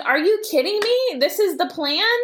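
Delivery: high-pitched, disbelieving outburst; incredulous. Retold or imitated. Mom reacting to her son's arms-dealer plan, incredulous. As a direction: challenge it in appalled disbelief, voice shooting up.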